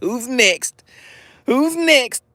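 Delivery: high sing-song call, who's next; bright announcer call for the next apology. Real, not acted. Calling the next chatter up.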